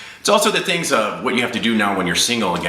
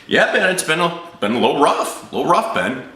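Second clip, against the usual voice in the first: high-pitched, awkward understatement; strained admission; 9 semitones higher than usual. Retold or imitated. Customer admitting his breakup hurts to insurance agent, high strained reply. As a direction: play it pained, pitch riding up.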